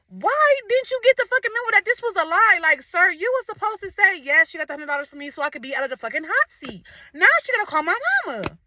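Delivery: high-pitched, wailing indignation; squeaky outraged pleading; retold betrayed rant. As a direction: whine it high in exasperated disbelief. Ranting at uncle who exposed lie.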